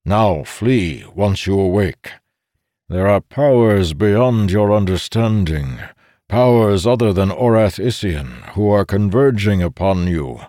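put-on a commanding old sage; old codger voice. A narrator's reading. Codger warning.

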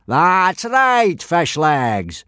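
loud, high booming approval. A narrator's reading. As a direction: boom it out. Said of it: "boomed Gobber".

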